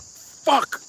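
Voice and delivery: put-on boy panicking at losing the goats, sudden high curse; scared curse. Boy realizes goats are gone.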